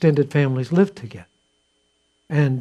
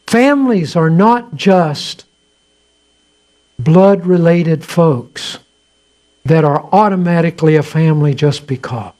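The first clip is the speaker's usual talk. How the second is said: emphatic preaching; 6 dB louder than usual. Real, not acted. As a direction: drive the point home to the congregation.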